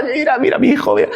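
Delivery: high, imitated crying prayer; re-enacted anguished begging; retold tearful plea. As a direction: plead upward as if weeping for your child. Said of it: "llorando diciendo, "Señor".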